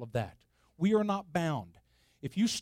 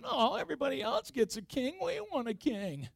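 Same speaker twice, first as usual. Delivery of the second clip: higher, nagging whine of the Israelites; whiny Israelites; 7 semitones higher than usual. Retold or imitated. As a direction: whine like spoiled people begging for a king. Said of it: "my own whiny Israeli voice".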